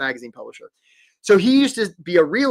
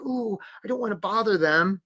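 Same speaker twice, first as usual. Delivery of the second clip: higher, mock-timid voice; timid job seeker; 4 semitones higher than usual. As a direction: play a reluctant, hesitant job seeker. Voices timid job-seeking competitors.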